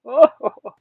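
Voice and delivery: breathy little oh-ho-ho; short amused chuckle; grandpa's chuckle. A narrator's reading. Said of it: "He looked in and did a chuckle".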